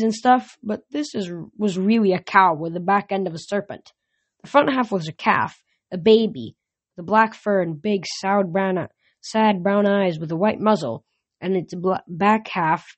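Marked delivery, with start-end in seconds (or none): none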